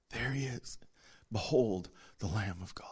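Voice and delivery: whispered, reverent behold; hushed whisper. Acted. Preacher unveils the Lamb of God to the congregation, whispering. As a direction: let it fall to an awed whisper.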